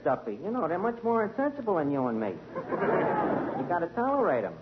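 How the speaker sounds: ordinary talk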